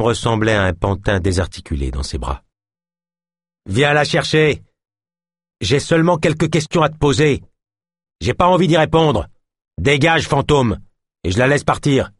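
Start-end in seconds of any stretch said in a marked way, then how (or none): 3.7-12.1 defiant shout. Acted. Philémon shouts defiance at Ganesh while holding the hostage. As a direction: throw it in the cop's face. Hostage-taker defies the cop.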